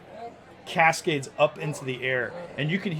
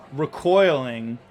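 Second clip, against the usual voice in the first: ordinary talk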